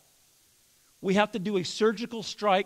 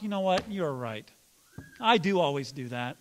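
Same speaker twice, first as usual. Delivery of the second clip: mock agreement; 5 semitones lower, 5 dB quieter than usual. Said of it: "the other person never responds".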